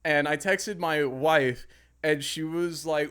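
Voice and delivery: normal talking voice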